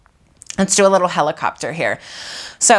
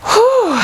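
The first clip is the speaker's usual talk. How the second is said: relieved whew; 4 dB louder than usual. Real, not acted. Instructor exhales after effort.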